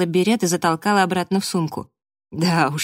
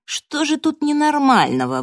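high, louder indignant question; 10 semitones higher, 3 dB louder than usual. A narrator's reading. Frank defending his idea to Cassie, high and indignant. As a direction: protest as if offended. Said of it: "возмутился Фрэнк".

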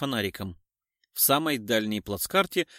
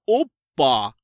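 cheeky exclamation; 8 dB louder than usual. Acted. Tramp's rude gesture.

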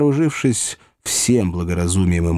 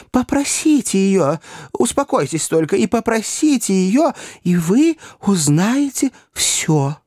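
straining plea; 10 semitones higher than usual. A narrator's reading. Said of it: "напрягая лёгкие до крайнего предела".

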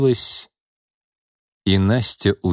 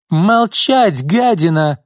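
much higher, louder shriek; 9 semitones higher, 5 dB louder, much slower than usual. Acted. Izolda shrieks at her sister to shut up. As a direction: lose control and screech. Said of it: "взвизгнула Изольда".

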